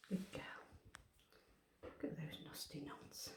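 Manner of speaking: whispered aside about the knots; hushed groomer's whisper. Real, not acted. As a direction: breathe it under your breath. Groomer tutting at cat's knots.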